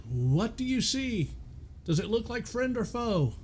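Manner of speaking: high-pitched captain's call up to a lookout; acted hail, pitched well up. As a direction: call out high across a stormy deck. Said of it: "she's calling out".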